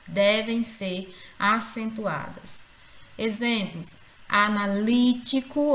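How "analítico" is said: analítico with LÍ punched and held; stress on LI. Shown as a demonstration. Teacher giving examples to the class, analítico stressed on LÍ. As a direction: hit the lí in analítico. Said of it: "olha aí o li".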